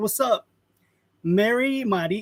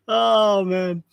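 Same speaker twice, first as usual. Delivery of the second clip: ordinary talk, nothing marked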